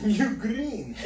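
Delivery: "green" high with a gratuitous rolled R. Shown as a demonstration. Showing friends the accent, rolling the R in "green". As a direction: trill the R of "green", mock accent. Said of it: "With an unnecessary R roll".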